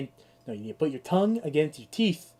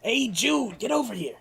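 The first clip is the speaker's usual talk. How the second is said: a lightly voiced character calling out, a bit higher; 7 semitones higher than usual. Streamer doing a game character, calling Jude over. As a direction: call out in a character voice. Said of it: "Hit me with my guy".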